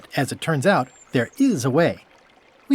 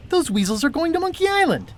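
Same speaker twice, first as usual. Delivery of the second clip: alarmed realization. Real, not acted.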